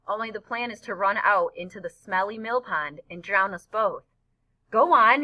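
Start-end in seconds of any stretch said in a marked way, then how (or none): 4.7-5.2 much louder, high shout. Acted. Boy shouts from his friend's shoulders to charge ahead, loud. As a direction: push him forward with a yell. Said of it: "he's shouting from above my head".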